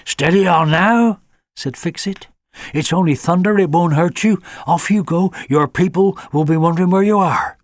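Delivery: a hint of a kindly old fox calming a puppy; fox Fix-It. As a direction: fox soothing a scared hound. Fix-It the fox reassures Alfie.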